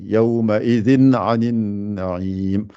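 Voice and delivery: يومئذ عن النعيم with the n sounded plainly before the ayn; izhar, clear n. Shown as a demonstration. Teacher showing izhar to students, clear n. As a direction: bounce straight from the n onto the ayn. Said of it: "je fais pas de runna ici".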